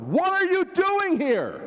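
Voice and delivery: high, drawn-out full-force yell; angry shout. Shown as a demonstration. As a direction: shout the whole question in anger. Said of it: "maybe just the whole thing was shouted".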